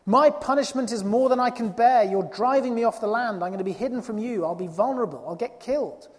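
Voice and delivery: protesting. Retold or imitated. Preacher voicing Cain protesting his punishment, loud and aggrieved. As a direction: complain loudly that it is too much to bear. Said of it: "He protests against the harshness of it".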